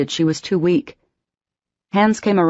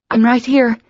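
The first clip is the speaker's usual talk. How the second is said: higher, louder call; 8 semitones higher, 4 dB louder than usual. Acted. Emma calling to Patrick on the stretcher, loud. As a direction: call across to him over the firemen. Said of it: "she called".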